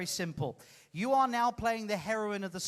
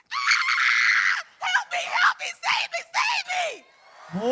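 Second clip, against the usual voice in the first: extremely high, piercing shrieks for help; acted terrified screaming; 7 dB louder, much slower than usual. Volunteer rehearses Mary's part for the audience, screaming scared. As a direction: scream the pleas in mock terror. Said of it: "Scared is what you've got to act".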